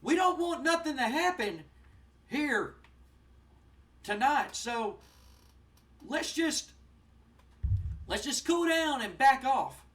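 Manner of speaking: high, loud, placating manager voice; hammed-up slick manager talking the guys down; Jim Cornette calming them down. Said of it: "Jim Cornette steps in and goes".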